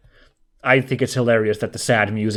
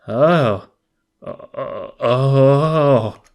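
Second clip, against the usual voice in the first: frightened gasp; 2 semitones higher, 3 dB louder, much slower than usual. Acted. Scared reaction to cacti.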